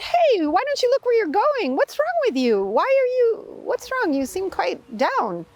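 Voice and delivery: put-on high, scolding old man's voice; an old man telling off a careless youth; grumpy old man.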